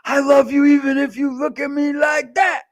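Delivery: high, loud cooing to a newborn; baby talk. Retold or imitated. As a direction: gush at the baby like a new dad. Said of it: "And I go".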